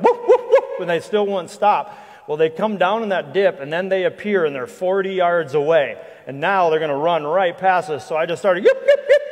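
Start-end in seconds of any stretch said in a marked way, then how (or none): 0.0-0.8 sharp, very high whoops, louder, laughing through them; high whooping calls. Retold or imitated. Guide retelling how he stopped the wolves, high loud whoops. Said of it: "So I start going". 8.6-9.2 very high, loud yipping cries; loud high yips. Retold or imitated. Guide showing the audience how he froze the wolves, loud high yips. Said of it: "really, really loud".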